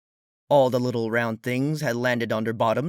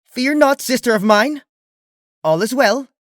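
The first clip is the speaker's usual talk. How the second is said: much higher, much louder proclamation; loud royal reassurance; 13 semitones higher, 9 dB louder than usual. A narrator's reading. Luna calls to Celestia behind the door, loud overbright. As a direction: bellow it out with false confidence.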